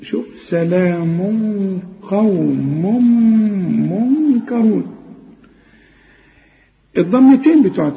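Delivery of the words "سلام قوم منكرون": سلام with a hidden nasal nunation before ق; ikhfa recitation. Shown as a demonstration. Teacher to student: slow demo of ikhfa in سلام قوم. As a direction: recite slowly, hiding the noon. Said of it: "نخفي هنا".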